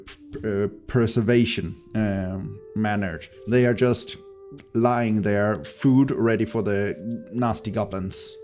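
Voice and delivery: ordinary talk, nothing marked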